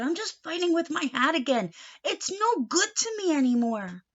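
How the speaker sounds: a touch of exasperation; mild grumble. Acted. Uncle to niece, mildly fed up.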